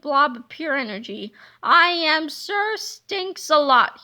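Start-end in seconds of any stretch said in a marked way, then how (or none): 1.6-4.0 slow, booming villain announcement; bellowed. Acted. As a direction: boom out your new name slowly. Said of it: "he bellowed".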